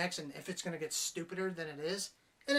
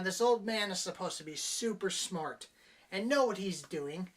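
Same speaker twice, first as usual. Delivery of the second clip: ordinary talk, nothing marked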